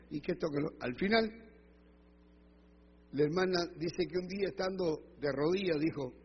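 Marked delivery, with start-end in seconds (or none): none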